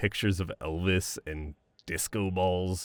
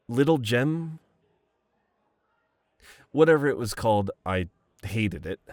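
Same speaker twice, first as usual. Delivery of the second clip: normal talking voice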